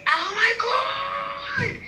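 drawn-out, very high shout of joy; excited shout. Real, not acted. Friend hears the client news, shouts in high drawn-out joy. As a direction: let it fly up in delight. Hears about the airline deal.

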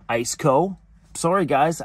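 ordinary talk

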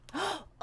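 a quick, high gasp of realisation; sharp gasp. Acted. Bored kid struck by an idea, sharp gasp. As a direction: suck in air as the idea lands.

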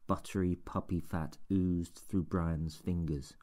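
plain reading voice

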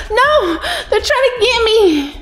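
high, half-laughing panic; panicked wail. Real, not acted. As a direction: cry out as they grab you. Caught and banned in game.

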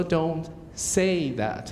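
very slow, careful "don't say that"; correct model. Shown as a demonstration. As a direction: spell it out slowly as the correct form. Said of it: "所以你只能说".

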